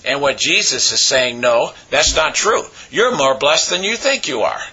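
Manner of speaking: ordinary talk, nothing marked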